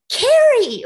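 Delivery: high, loud sing-song call of a name. Retold or imitated. Neighbor at the mailbox calling out her name, high and loud. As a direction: call across the street brightly.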